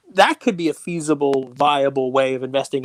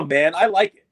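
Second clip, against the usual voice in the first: ordinary talk, nothing marked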